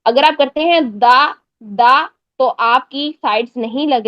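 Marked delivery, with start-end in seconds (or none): 0.8-2.1 دا with the tongue tip on the front teeth; wrong thin dal. Shown as a demonstration. Teacher shows students the error, thin frontal dal. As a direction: say دا light and frontal, like a plain dal. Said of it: "آپ کا دال بن رہا ہے".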